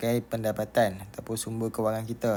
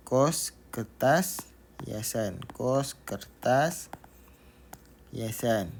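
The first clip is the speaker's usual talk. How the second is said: slow repeated reading; much slower than usual. Shown as a demonstration. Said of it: "teacher repeats the phrase slowly".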